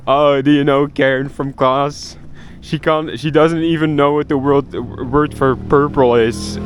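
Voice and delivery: mock the girl who can't speak English; mocking schoolkid gossip. Mimicking classmates gossiping about Karen in the hallway, sneering and slow. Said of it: "We would talk about it in the hallways".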